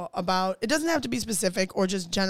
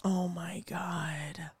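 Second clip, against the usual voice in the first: whispering; 3 semitones lower, 7 dB quieter, much slower than usual. Real, not acted. Stunned reaction to scar story.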